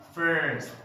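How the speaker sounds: "First" with a strong American R; emphasized R in "First"; stressed R. Shown as a demonstration. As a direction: lean on the R in "First". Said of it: "they stress the R".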